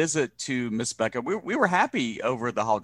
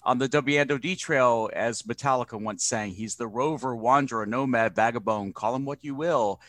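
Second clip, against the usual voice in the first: ordinary talk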